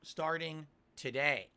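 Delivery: dramatic announcement, each word set apart; show host announcer. Acted. Host announces seven straight streaming days, emphatic announcer style. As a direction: punch out each word with big pauses.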